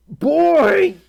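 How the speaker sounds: high, loud startled exclamation; surprised shout. Real, not acted. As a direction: blurt it out in shock. Reacting to hundred dollar tier.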